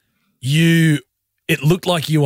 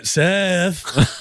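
long drawn-out, slightly raised name; calling to bawling baby; 4 semitones higher than usual. Retold or imitated. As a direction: draw the name right out to the crying baby. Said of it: "When he's bawling".

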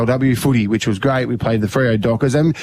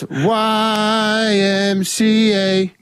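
very slow, higher Y M C A; insisting slowly on the letters; correcting YMCA; 8 semitones higher, much slower than usual. Shown as a demonstration. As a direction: stretch each letter out deliberately. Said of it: "You do a weird C".

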